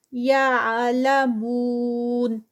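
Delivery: slow drawn-out tajweed recitation, vowels held; Quran recitation. Shown as a demonstration. Tajweed teacher reciting a verse for students, slow melodic recitation. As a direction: chant each word with its lengthening. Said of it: "مون پہ آگے four counts".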